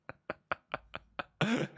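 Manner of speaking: high, voiceless laughing fit; helpless high-pitched giggling without words; real laugh. Real, not acted. Laughing at own fart joke.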